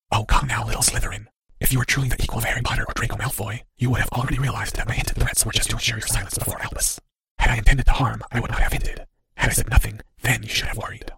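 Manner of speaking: soft, voiceless, condescending whisper. A narrator's reading. Cloaked figure chides the little Slytherin, whispered. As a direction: whisper it like a secret rebuke. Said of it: "said the whisper".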